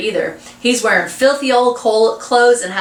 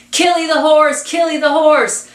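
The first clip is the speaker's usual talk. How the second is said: high, louder taunting chant; mocking cries; 8 semitones higher, 4 dB louder than usual. A narrator's reading. Boys chase the old man's wagon, mocking him. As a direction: jeer it like a pack of boys. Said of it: "Their mocking cries fill the lane".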